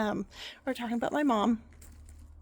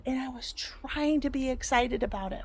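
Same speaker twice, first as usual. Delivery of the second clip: normal talking voice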